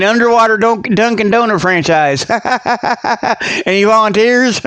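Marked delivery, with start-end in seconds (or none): 2.3-3.6 soft chuckle; laugh. Real, not acted. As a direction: chuckle at your own joke. Laughs at own joke.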